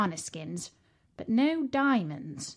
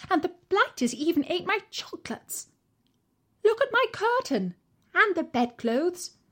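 lady of the house; 6 semitones higher than usual. A narrator's reading. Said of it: "she said to her husband".

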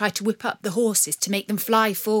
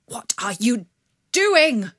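alarm bursting out; father crying out to his daughter, whisper to shout; 3 semitones higher, 6 dB louder than usual. Acted. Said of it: "cried the father".

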